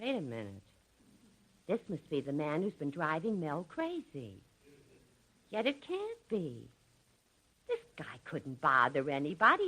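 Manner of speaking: a hushed private aside behind the customer's back; quiet aside. Acted. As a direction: mutter it to yourself, away from the customer. Clerk's aside to herself.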